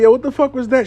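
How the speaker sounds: high-pitched startled question; acted startled alarm. Reader on the phone hears a noise, acted alarm. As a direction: replay being jolted by a sudden noise.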